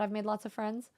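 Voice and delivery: ordinary talk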